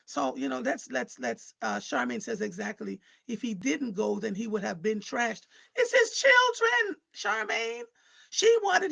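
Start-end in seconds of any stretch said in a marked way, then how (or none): 5.8-8.9 high, loud and slowed, pressing the point home; emphatic outburst. Real, not acted. To the caller about his children, an emphatic loud outburst. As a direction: insist hard, every word weighted. Insisting on the father's rights.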